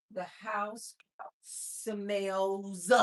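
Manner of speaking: ordinary talk, nothing marked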